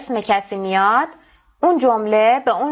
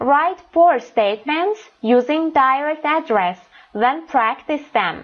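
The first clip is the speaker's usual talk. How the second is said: slow careful reading; 4 semitones higher, slower than usual. Shown as a demonstration. Teacher reads instructions aloud.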